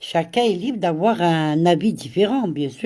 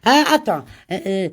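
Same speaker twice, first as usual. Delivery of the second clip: ordinary talk, nothing marked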